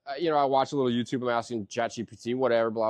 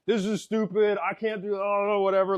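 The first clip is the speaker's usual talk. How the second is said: higher, whiny tantrum outburst; 7 semitones higher than usual. Retold or imitated. Frustrated editor at his desk, retold: half tantrum. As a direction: throw a little tantrum at the screen. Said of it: "I wanna throw a tantrum".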